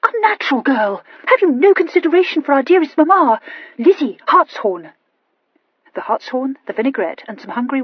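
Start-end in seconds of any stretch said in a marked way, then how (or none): 0.0-4.9 high, loud shriek. A narrator's reading. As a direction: scold her sister at full pitch.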